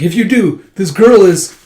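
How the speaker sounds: high, loud, unfinished threat; hostage threat. Acted. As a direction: shout a warning over a hostage. Hostage taker threatens, cut off.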